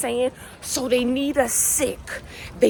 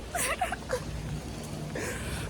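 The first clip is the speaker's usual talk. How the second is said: laugh; 12 dB quieter than usual. Real, not acted. Laugh after animal voice.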